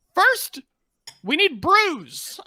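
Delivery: high, loud, slow goblin order; goblin demand. Acted. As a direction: stand tall on the stool and demand drinks. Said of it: "and I'll say".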